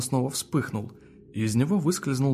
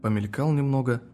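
ordinary delivery

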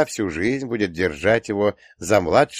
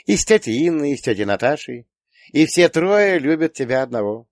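put-on high, teasing girlish listing; sly; 8 semitones higher, 5 dB louder than usual. A narrator's reading. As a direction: press the point slyly, pitched high. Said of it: "Доча коварно заулыбалась". (лукаво, высоким девичьим голосом)

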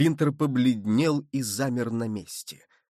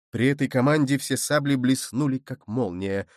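ordinary delivery, nothing marked

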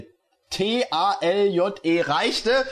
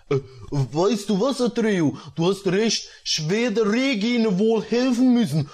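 lightly voiced boyish hero; a hint of a put-on young boy; mildly resigned character voice; Basti-chan; 3 semitones higher than usual. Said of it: "die Stimme von Basti-chan wiederfinden".

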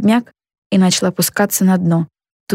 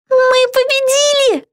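high, drawn-out victory cry; joyful exclamation; slower than usual. A narrator's reading. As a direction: exclaim in high-pitched triumph. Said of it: "радостно воскликнула Битти". (радостный протяжный возглас высоким голосом)

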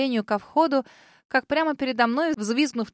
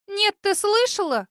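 high, outraged exclamation; indignant exclaim; 11 semitones higher, 6 dB louder, slower than usual. A narrator's reading. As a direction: cry it out in outrage. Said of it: "восклицает одна".

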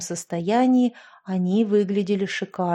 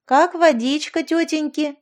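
mocking tease; 6 semitones higher, 3 dB louder than usual. Mocking blondes tease the friends.

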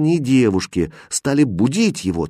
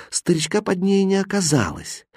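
ordinary delivery

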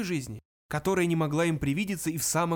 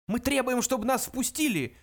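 shouted protest, pitched way up; indignant cry; 9 semitones higher than usual. A narrator's reading. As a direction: cry out an angry demand to be let in. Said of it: "вскричал младший из них".

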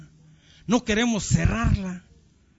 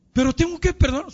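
high-pitched mock objection; reluctant protest; 8 semitones higher than usual. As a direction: imitate a plaintive, unwilling wife. Woman reluctantly questions the pastor.

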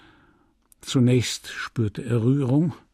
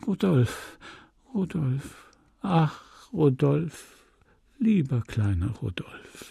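put-on childlike, tearful voice; 2 semitones higher, slower than usual. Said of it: "mit kindlicher Stimme, obwohl ihr dicke Tränen".

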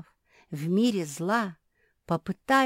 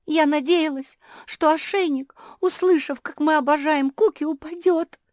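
very high, loud tearful wail; crying; 10 semitones higher, 9 dB louder than usual. A narrator's reading. Жози hoped love would drop the collar, wailing. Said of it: "заплакала Жози".

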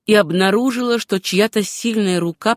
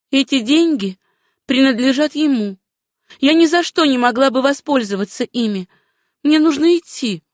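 higher-pitched female character; insistent heroine; 5 semitones higher than usual. Acted. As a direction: lift the pitch and insist on refusing. Plays Susanna refusing the money.

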